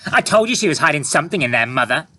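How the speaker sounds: put-on high, loud, fast girl's exclamation; exclaiming. A narrator's reading. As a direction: blurt it out triumphantly. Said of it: "exclaimed Annabel".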